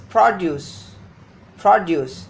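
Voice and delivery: produce with the stress on PRO; PROduce, strong open first syllable. Shown as a demonstration. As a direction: say produce as the noun, hitting pro-. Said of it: "This syllable is stressed".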